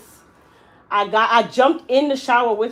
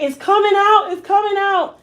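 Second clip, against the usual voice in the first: high, loud distressed cry; crying wail; 10 semitones higher, 4 dB louder than usual. Retold or imitated. Mother in the shower seeing her hair fall out, crying wail. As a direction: wail it out in shock and tears. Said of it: "And she's crying".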